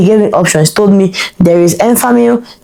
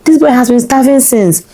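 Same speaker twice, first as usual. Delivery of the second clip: shocked realization; 7 semitones higher, slower than usual. Retold or imitated. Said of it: "So I was like".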